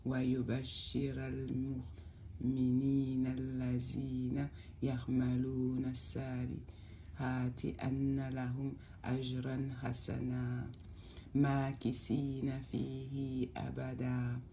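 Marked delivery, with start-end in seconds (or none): none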